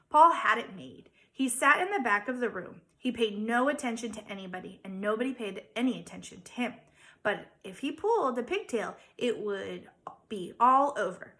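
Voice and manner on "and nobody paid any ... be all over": plain reading voice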